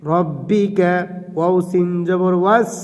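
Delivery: normal talking voice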